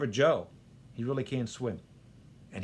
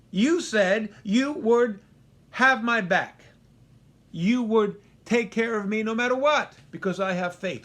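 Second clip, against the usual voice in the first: aggrieved lament; 7 semitones higher, 6 dB louder than usual. Retold or imitated. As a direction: pour out the grievance. Joe confronting God.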